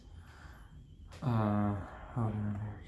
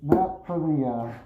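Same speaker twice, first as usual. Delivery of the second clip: higher, louder, muffled by a gas mask; loud through gas mask; 4 semitones higher than usual. Real, not acted. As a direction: project loudly through a respirator. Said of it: "try to speak a little louder".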